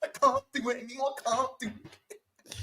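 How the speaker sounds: high, whining fan at the end of his tether; Saeed. Acted. Impersonating a fan sick of the manager, high defeated whine. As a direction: plead in a high, worn-out wail. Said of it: "Yeah, that's the one".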